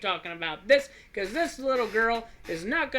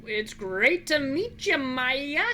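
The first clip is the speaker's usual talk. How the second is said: forced cheer; 7 semitones higher, 5 dB louder, much slower than usual. Acted. Player greets child with forced cheer.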